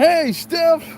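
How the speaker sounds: high, bright mock shout of Steph's name; eager fan greeting. Fan spotting Steph in his neighborhood, high mock greeting. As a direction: call out to him like an overexcited fan. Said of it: "He's like".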